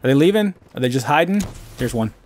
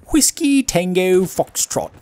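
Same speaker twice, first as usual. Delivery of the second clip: higher, slow mock English accent; 5 semitones higher, slower than usual. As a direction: imitate her English accent, stretching each word. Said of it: "I love that accent".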